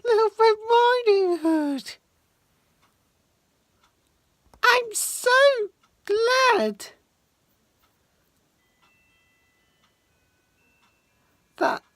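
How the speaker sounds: high, lightly voiced grandma; grandma's voice. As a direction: greet the granddaughter as a relieved grandma. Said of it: "Grandma says".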